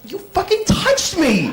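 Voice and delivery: high, slow hammed-up outrage; mock furious stranger. Touchy stranger at the bus stop, hammed-up outrage. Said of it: "he's gonna go".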